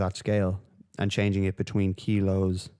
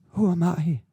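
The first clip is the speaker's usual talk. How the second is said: jumps much higher and louder, mock innocent; mock squeak; 9 semitones higher, 4 dB louder, slower than usual. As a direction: toss it off in a squeaky mock-modest voice. Repeats question, second mocking.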